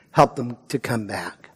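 whispered, pleading; a whispered appeal to the church; whispered plea. Acted. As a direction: breathe the words out. Soft closing plea.

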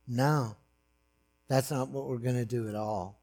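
a calm, smiling refusal from above; gentle voice of God. Acted.

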